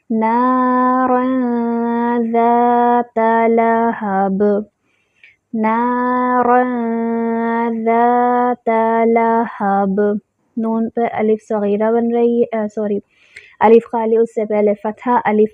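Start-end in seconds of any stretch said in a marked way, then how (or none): 0.1-10.0 slow drawn tajweed chant; measured recitation; Quran recitation. Shown as a demonstration. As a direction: recite slowly, holding the vowels. Teacher recites verse slowly.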